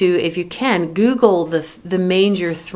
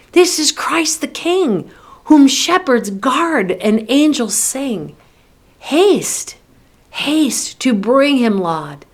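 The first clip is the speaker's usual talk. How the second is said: higher-pitched, ringing emphasis on "This is Christ the King"; emphatic; 5 semitones higher than usual. Acted. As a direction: call out the refrain emphatically, "Haste" pitched high. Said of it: "to me, it sounds so emphatic".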